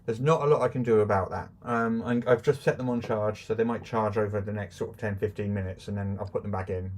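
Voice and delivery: sounding far off down a well. Real, not acted. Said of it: "you do sound like you're at the bottom of a well".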